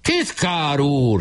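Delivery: high, very slow call after; shrieked call. A narrator's reading. Weiss shrieks after Nemecsek, high and stretched. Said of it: "süvöltött utána Weiss".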